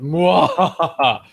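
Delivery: hammed-up villain cackle; playful "mwah-ha-ha"; mock evil laugh. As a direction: laugh like a pretend evil mastermind. Mock-villain claim of admin powers.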